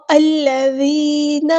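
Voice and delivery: الذین drawn out slowly with a soft light ذ and long ی; soft light dhal. Shown as a demonstration. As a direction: stretch الذین, the ذ gentle. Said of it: "دال کو باریک نرمی سے ادا کرنا ہے". (الذین آہستہ، ذال باریک اور نرم)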